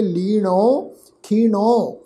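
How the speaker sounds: "ਲੀਣੋ, ਖੀਣੋ" ending in a long au vowel; open au ending. Shown as a demonstration. Teacher modelling two scripture words, "ਲੀਣੋ, ਖੀਣੋ" with au endings. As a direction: draw out the final au of "ਲੀਣੋ, ਖੀਣੋ". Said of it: "ਕਨੋੜੇ ਦੀ ਮਾਤਰਾ ਲਗਾ ਕੇ".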